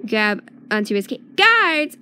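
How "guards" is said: loud, high call for the guards; holler. A narrator's reading. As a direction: holler across the room for help. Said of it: "hollers Thor".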